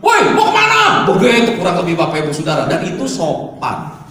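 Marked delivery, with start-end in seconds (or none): 0.0-1.1 high, loud, drawn-out Surabaya call; Surabaya shout. Retold or imitated. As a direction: call out to a friend, Surabaya style. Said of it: "Ngomongnya itu".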